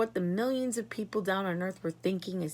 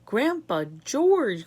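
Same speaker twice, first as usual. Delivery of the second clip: slow, loud call to grandpa; pleading cry; 4 semitones higher, 8 dB louder, much slower than usual. A narrator's reading. Charlie calling his grandpa to calm down, slow and loud. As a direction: reach him across the noise. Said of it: "cried Charlie".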